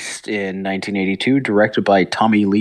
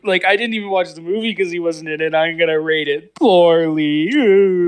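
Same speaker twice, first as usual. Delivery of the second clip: high, mocking whine; whiny fan voice; 7 semitones higher than usual.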